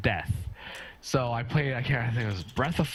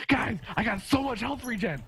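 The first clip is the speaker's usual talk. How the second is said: high-pitched, excited mock boast; excited teammate quote; 9 semitones higher than usual. Player boasting to teammates in game, excited. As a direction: crow to the team, higher than usual.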